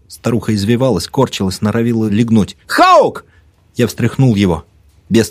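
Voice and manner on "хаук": high, raised cry of the name; urgent shout. A narrator's reading. Narrator shakes his dazed friend, shouting. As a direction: shout to jolt him awake.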